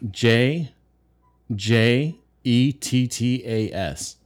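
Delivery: slow letter by letter; spelling it out. Shown as a demonstration. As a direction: spell each letter out slowly. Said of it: "Spell that for me".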